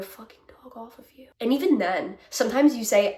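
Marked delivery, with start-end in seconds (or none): 0.0-1.3 breathy muttered curse; whispered aside. Real, not acted. Venting to viewers, a whispered aside. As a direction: drop to a whisper for the swear.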